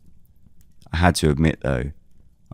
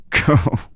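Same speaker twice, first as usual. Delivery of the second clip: short laugh; 11 semitones higher, 4 dB louder than usual. Acted. James amused, teasing Alfred.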